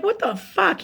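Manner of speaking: as if you can't believe what you just heard; disbelieving outburst. Retold or imitated.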